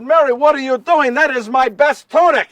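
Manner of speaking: loud, high, exasperated objection; indignant protest. Acted. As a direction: protest in alarm at the misuse of your things. Joseph protests as best tunic is used.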